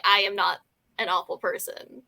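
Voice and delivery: ordinary talk, nothing marked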